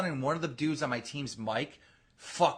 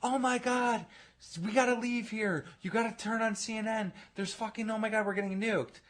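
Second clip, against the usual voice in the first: higher-pitched mock mom in a fluster; imitating panicked mom; 8 semitones higher, 3 dB quieter than usual. Mom storms in warning of a nuke, mock panic. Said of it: "His fucking mom comes storming in the room".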